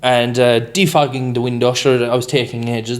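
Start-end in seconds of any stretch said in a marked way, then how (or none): none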